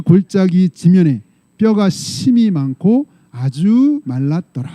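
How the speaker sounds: slow, loud unison reading; loud scripture reading. Acted. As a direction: read the verses out slowly and loudly. Congregation reads scripture aloud together. (크고 느린 성경 봉독)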